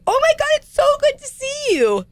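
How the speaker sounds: high, gushing fake-friendly voice; gushing greeting. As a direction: gush like an overexcited acquaintance. Imitating overly friendly stranger.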